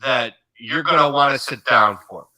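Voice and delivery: ordinary talk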